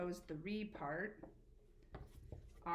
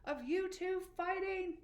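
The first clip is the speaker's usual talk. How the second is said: high, louder fed-up parent voice; exasperated parent; 10 semitones higher, 5 dB louder than usual. Retold or imitated. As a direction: scold like a tired parent breaking up a fight. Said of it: "your parent might say to you".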